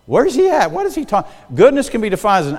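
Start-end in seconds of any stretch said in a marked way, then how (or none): 0.0-1.3 high, puzzled mock congregant; confused listeners. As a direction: play the lost listener, pitched up. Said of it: "when people start going".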